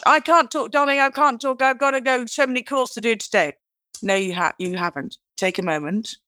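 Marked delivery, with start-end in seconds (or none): 0.0-3.5 high, loud, hurried I can't talk; busy caller brushing off family; rushed busy person. Retold or imitated. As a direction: blurt it out like someone dashing off.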